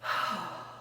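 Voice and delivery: long weary exhale; heavy sigh. Real, not acted. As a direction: let out a tired sigh. After crying.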